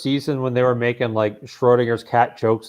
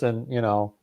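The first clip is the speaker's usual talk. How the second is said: ordinary talk, nothing marked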